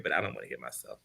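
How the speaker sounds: laughing. Real, not acted. Joking about hearing himself.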